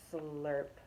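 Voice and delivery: ordinary delivery, nothing marked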